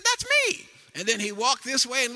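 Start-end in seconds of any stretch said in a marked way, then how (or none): 0.0-0.7 put-on very high, loud little boy crying out; preacher imitating his grandson's delighted yell; excited toddler shout. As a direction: shout it like a thrilled three-year-old. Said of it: "He said".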